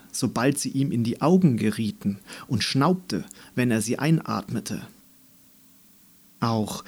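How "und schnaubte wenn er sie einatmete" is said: ordinary delivery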